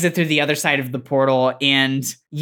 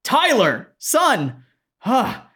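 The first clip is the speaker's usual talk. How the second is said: raised, hammy video-game dad voice; generic game dad; 10 semitones higher, much slower than usual. Acted. Generic dad calls out to his son, hammed-up. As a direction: call out like a stock game dad. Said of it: "it sounds like a dad voice from a video game".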